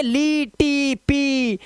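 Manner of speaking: ली, टी, पी each a long, high "ee"; wrong EE readings. Shown as a demonstration. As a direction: draw out ली, टी, पी as counterexamples. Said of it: "डी नहीं है". (ली, टी, पी में लंबी ई)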